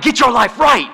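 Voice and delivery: high-pitched hammed-up scolding; mock scolding. Bible-smacking Christians to non-Christians, mock scolding. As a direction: scold it high and punchy, in mockery.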